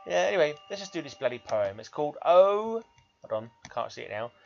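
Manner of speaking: normal talking voice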